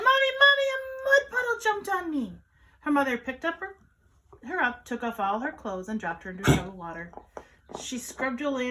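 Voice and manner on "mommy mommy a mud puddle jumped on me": high, loud wail to Mommy; loud, high-pitched tattling yell, falling off at the end; child yelling. A narrator's reading. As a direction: cry out as an upset little kid. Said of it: "Julian ran inside yelling".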